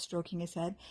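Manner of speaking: plain reading voice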